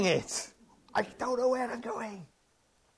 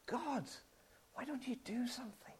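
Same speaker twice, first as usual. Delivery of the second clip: hushed, desperate whisper to God; whispered cry; 5 semitones higher, 17 dB quieter than usual. Pretend. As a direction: whisper it like a broken plea. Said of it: "you cry out to him".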